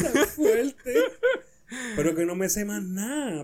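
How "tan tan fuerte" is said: high, laughing words. Real, not acted. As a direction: laugh while saying it. Laughing at his crude joke.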